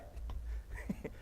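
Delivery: a short airy burst of laughter under the breath; breathless laugh. Real, not acted. Laughing softly to the crowd at his own story, an airy laugh. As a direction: huff out a quiet laugh. Laugh after retelling joke.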